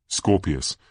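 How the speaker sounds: plain reading voice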